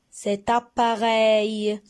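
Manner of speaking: cet appareil said slowly, syllables drawn out; slow model word. Shown as a demonstration. Teacher models the word for pupils, slowly. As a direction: draw out cet appareil clearly. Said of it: "teacher models word".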